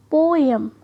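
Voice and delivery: पोएम drawn out as po-em, both vowels separate; two vowel sounds. Shown as a demonstration. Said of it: "दो अलग-अलग sound produce कर रही है". (पोएम को दो सिलेबल में खींचकर बोलें)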